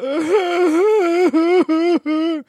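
loud, high wailing sob; long hammed-up crying howl; mock bawling. Shown as a demonstration. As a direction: imitate yourself bawling in a high wail. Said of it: "I just start bawling".

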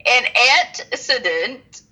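"antecedent" drawn out slowly in separated pieces; slow, careful pronunciation. Shown as a demonstration. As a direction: sound out "antecedent" with deliberate pauses. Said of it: "I had to say it slow".

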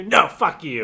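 loud rude dismissal; rude teddy voice. Pretend. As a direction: snap it back rudely.

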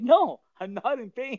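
high-pitched retold denial; retold protest. Driver denying pain to the officer, retold high-pitched protest.

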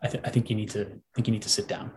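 hushed, mock-serious warning; mock grave. Teasing the co-host before bad solar news, mock grave. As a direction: drop low and soft like breaking bad news.

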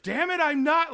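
hammed-up angry caller; imitating angry yell. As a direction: act out the fed-up woman cursing. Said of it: "she keeps yelling back at them".

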